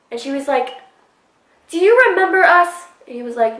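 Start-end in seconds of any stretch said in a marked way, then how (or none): 1.7-2.8 high, louder quoted question; friend's pointed question. Retold or imitated. Friend asks Evan if he remembers them, imitated high voice. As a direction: imitate the friend pitched well up.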